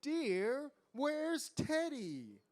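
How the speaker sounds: high, slow, swooping motherly question; wondering queen. A narrator's reading. Queen asks her husband about their son, wondering. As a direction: call out sweetly to a spouse, drawn out. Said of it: "the queen wondered".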